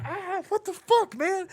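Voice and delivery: very high slow yelp of pain. Retold or imitated. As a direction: yelp after the stiletto hit. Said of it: "I'm like".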